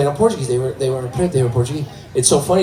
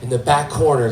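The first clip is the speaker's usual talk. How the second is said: ordinary talk, nothing marked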